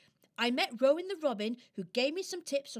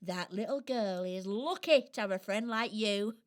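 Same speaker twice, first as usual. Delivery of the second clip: plain reading voice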